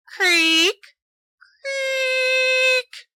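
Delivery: high squeaky double creak; creaking floorboards. Acted. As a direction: let the floor creak twice, long. Narrator voices creaking floor.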